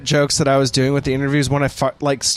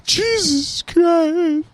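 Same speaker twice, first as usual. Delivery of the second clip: mock despair; much slower than usual. Mock despair after laughing.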